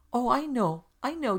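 put-on girl bursting into the conversation; eager interruption. A narrator's reading. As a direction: burst in, unable to wait.

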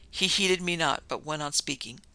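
ordinary delivery, nothing marked

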